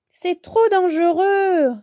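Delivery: high, slow, drawn-out warning; alarmed warning. Acted. Bibou warns Yo-Yo about the scooter, alarmed. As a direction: warn a friend off the danger.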